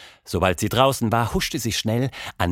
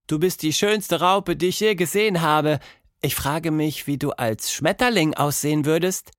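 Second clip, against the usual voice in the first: put-on high, wondering little girl voice; a child in awe, higher voice; admiring girl; 7 semitones higher than usual. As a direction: marvel at the caterpillar. Tilda admires caterpillar.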